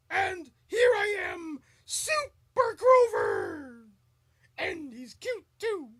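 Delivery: high, loud heroic puppet proclamation; Super Grover. Acted. Host imitating a superhero puppet, high proud shout. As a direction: announce yourself in a shouted puppet falsetto, stretching the name. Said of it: "That's Grover and Super Grover".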